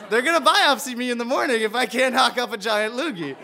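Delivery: can't help laughing as he tells it. Real, not acted. Telling the audience about the loogie mission, laughing.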